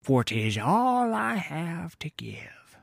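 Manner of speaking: slow, whispered captain voice; imitating the captain. Sailor imitating his lying captain, slow whisper. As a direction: whisper it like a false pious vow. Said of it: "he said".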